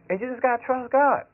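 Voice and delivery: ordinary talk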